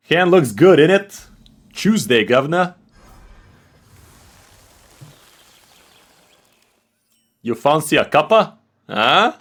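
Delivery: hammed-up high British accent; mock British accent. Streamer proving to chat he can do a British accent. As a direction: put on a cheeky cockney British voice. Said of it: "I don't have the Brit accent".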